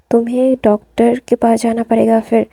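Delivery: ordinary delivery, nothing marked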